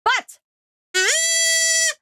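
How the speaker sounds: high, loud squeal of joy; excited squeal. Real, not acted. Streamer squealing as the Mon raid arrives. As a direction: squeal the welcome like a fan.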